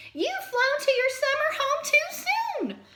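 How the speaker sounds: high, loud, excited little fox voice; Fletcher crying out. A narrator's reading. Fletcher the fox warns the birds, high and loud. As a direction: cry out the warning in a high fox voice. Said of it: "cried Fletcher".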